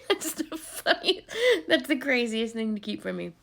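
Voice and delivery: laughing through the words; real amused laughter while speaking. Real, not acted. Laughing at husband's secret.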